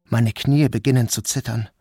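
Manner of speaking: plain reading voice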